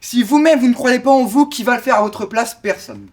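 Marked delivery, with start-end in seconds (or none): 2.5-3.1 dropped very low and softer; grave answer. Acted. Orator answers own question to the public, grave answer. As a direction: let the answer fall away, deep and quiet.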